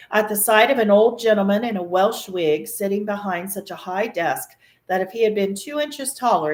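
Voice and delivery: ordinary delivery, nothing marked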